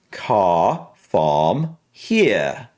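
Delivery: slow British pronunciation, R dropped; British accent. Shown as a demonstration. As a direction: draw the words out in a British accent. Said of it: "And for British".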